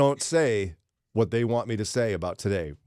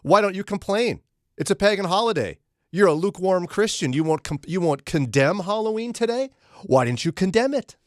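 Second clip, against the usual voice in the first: high, louder needling taunt; taunting caller; 8 semitones higher, 4 dB louder than usual. Retold or imitated. Host imitating a caller who taunts him, high loud needling mock voice. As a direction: goad him like a heckler. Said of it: "somebody will say".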